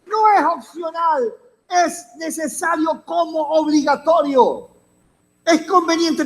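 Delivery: high-pitched, insistent pulpit delivery; forceful preaching. Real, not acted. Preacher urging the congregation to attend to the Father's business, insistent high preaching. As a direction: drive each point home to the congregation.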